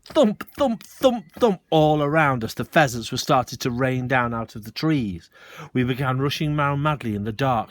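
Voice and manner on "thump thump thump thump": loud high thuds; loud thumps. A narrator's reading. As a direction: hammer each thump. Thumps continue.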